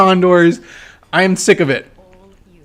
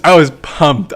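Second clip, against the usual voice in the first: normal talking voice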